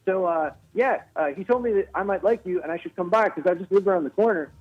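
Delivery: high-pitched, breathless talk-show host impression; eager greeting, pitched well up, a little out of breath; Jimmy Fallon. Host introducing himself to a comedian at the club, breathless impression. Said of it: "that is a great impression".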